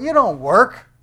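high, loud dismissal; scolding rebuke. Acted. Preacher answering the crowd's offer to work, loud scolding. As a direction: shoot down their offer loudly.

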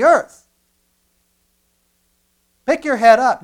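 loud, high preaching, building; rising sermon fervor; preaching at full force. Acted. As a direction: cry it out from the pulpit.

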